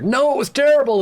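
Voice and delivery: high anguished protest; distressed cry. Acted. Apprentice crying out about the attack, high and upset. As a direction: burst out in distress.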